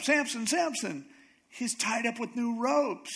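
high-pitched mock alarm; high imitation of a woman raising alarm; urgent wake-up call. Acted waking cry to Samson.

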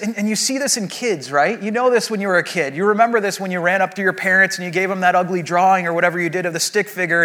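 usual speaking voice

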